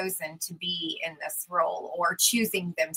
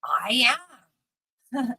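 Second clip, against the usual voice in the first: ordinary delivery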